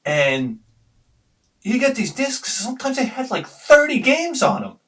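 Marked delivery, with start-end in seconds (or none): none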